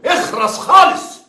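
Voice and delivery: high, loud, slow shout to shut up; angry shout. Acted. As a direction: bark him into silence. Investigator silences suspect.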